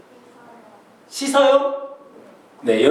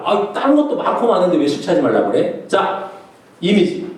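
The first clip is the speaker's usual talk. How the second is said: usual speaking voice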